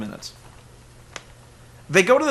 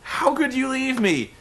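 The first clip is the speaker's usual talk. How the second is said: mock Dixie wail; 8 semitones higher than usual. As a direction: overplay a betrayed boss.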